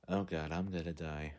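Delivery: soft, low mock dread; doomed inner thought. Piet on the bridge thinking of his fate, soft mock dread. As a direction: think it under your breath, resigned. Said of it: "like, thinking, like".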